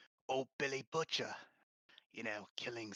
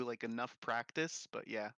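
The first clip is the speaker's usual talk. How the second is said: ordinary talk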